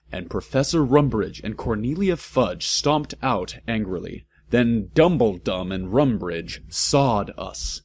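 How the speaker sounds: usual speaking voice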